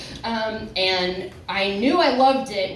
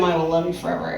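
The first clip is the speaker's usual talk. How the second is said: ordinary talk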